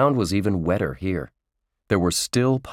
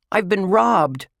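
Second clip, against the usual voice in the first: high, loud wail of outrage; alarmed yell; 13 semitones higher, 5 dB louder than usual. A narrator's reading. Josh tells friends he was robbed, alarmed yell. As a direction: announce a terrible crime, loudly. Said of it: "he yelled".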